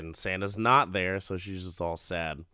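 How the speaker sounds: ordinary delivery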